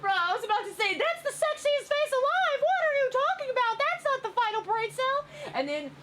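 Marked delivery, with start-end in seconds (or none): 1.0-5.2 high-pitched indignant protest; mock indignant. Acted indignant retort to the caption.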